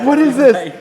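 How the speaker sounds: half-laughing retold panic; freaking out. Founder recalling hiding in the bathroom over the FBI letter, loud alarmed cry. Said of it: "hiding in the bathrooms".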